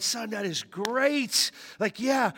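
a hint of a praising mom; mother cheering on her son; lightly voiced mom. Voicing his mom replying.

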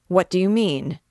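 the king turning sharply on his barber; startled demand. Acted. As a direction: snap the question out in alarm.

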